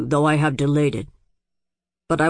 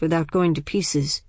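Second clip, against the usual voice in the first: ordinary delivery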